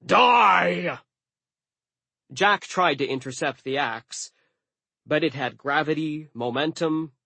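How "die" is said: high, loud battle yell; drawn-out angry shout; giant's yell. A narrator's reading. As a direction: bellow it as an attacking giant. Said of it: "Suit Tung yelled".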